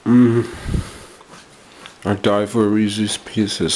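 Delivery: talking through a mouthful of candy; chewing while speaking; mouth full. Real, not acted. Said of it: "I'm talking with my mouth full now".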